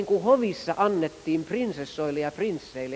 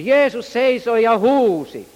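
loud, slow, high proclamation; preacher's shout; 6 semitones higher, 8 dB louder, slower than usual. Shown as a demonstration. Preacher showing how Jesus cried out, loud and slow. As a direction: cry it out like old-time preachers. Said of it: "niin puhujat huusi välillä".

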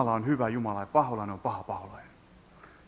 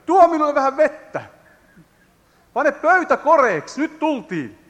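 much higher, loud call from afar; shouted command; 12 semitones higher, 8 dB louder than usual. Acted. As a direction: Elijah calls out to the widow, loud. Elijah calling to the widow. (Elia huutaa leskelle kovaa)

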